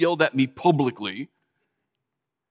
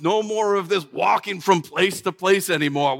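ordinary delivery

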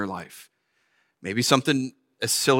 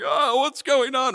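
flustered; 10 semitones higher, slower than usual. Retold or imitated. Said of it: "I was like".

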